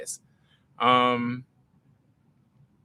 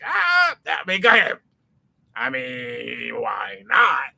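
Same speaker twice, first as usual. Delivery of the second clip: much higher, louder tempting inner voice with a cry and a shout; PP character voice; 3 semitones higher, 4 dB louder, slower than usual. Acted. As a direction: play the sly inner voice egging him on. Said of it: "I don't know why that was the voice I chose".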